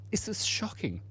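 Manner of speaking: hushed fake horror; a playful stage whisper; mock shocked whisper.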